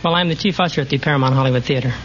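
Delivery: ordinary talk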